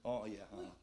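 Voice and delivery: low, soft, absent-minded mumbling; half-listening with a low murmur of yeses; bored partner barely paying attention; distracted girlfriend. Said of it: "Si pacar kamu".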